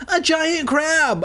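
panicked shout; shouted alarm. A narrator's reading. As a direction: yell the warning in shock. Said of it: "I shouted".

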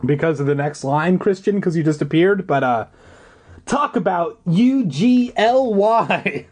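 usual speaking voice

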